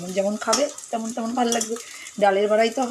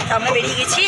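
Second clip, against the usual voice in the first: ordinary talk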